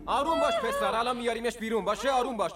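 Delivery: loud, high call to calm down; urgent calming call. Acted. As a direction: shout reassurance across. Calling to calm panicking friend.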